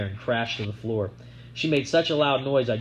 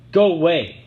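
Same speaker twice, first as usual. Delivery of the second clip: yelling; 6 semitones higher, 9 dB louder than usual. A narrator's reading. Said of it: "I yelled at her".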